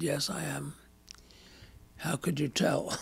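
softly, almost whispering, as the stranger; hushed retold reply. Out-of-place visitor answering a woman in the club, hushed. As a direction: imitate a meek, hushed answer.

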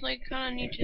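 ordinary delivery

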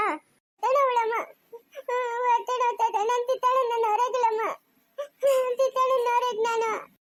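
put-on pained, childlike wailing; tearful labour pain. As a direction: plead tearfully through the pain. Labour pain, wanting absent loved one.

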